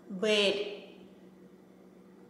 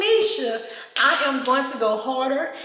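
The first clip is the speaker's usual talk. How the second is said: ordinary delivery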